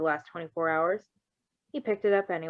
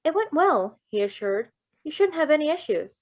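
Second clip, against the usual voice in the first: lightly voiced doctor, higher and louder; a hint of a calm surgeon reassuring his patient; light doctor voice, pitched up; 8 semitones higher, 5 dB louder than usual. Doctor's voice in the story.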